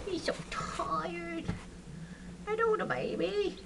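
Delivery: high, soft coo. Real, not acted. Keeper greeting a tired chick, soft high coo.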